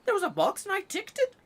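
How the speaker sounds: squeaky fast mock outrage at the form; mock exasperation. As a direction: insist shrilly that you clicked it. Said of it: "And I'm like".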